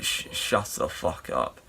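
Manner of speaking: whispered and low; a low, whispered brush-off; muttered whisper. Retold or imitated. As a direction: hiss it under the breath. Retold rude refusal.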